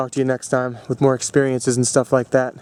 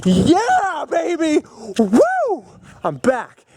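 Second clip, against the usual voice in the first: very high celebratory whooping; retold burst of elation; happy, excited cheer; 13 semitones higher, much slower than usual. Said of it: "I was so happy and excited".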